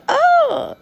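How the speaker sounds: a very high, loud surprised oh; a high startled oh. Retold or imitated. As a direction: squeak it out in surprise. Girl reacts to boy.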